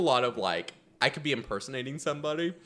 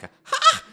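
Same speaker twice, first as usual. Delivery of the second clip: startled high yelp, loud; sudden loud sound; 10 dB louder than usual. Shown as a demonstration. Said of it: "a loud sound".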